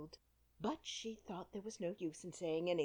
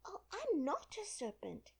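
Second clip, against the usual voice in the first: higher, offended protest; indignant; 12 semitones higher than usual. A narrator's reading. Alice protests indignantly to the pigeon. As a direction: bristle at the accusation. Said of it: "said Alice indignantly".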